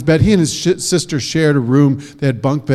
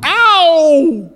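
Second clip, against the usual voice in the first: high sharp ow; yelp of pain; 6 dB louder than usual. Acted. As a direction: yelp as he hits the road. Boy falls from bus.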